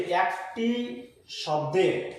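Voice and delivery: word by word, long pauses between; slow dictation. Real, not acted. Teacher writing out the syllable definition for students, slow dictation. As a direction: dictate it piece by piece as you write.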